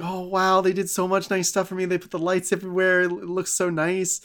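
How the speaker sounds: high-pitched imitated wonder; mock delight. Peter sees the lights put up for him, mock delight. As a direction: gush sweetly, like someone touched by a surprise.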